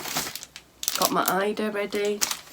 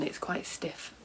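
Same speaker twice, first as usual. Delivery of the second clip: whispered aside; 12 dB quieter, slower than usual. Real, not acted. Repeats quiet, unvoiced.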